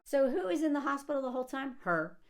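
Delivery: ordinary talk, nothing marked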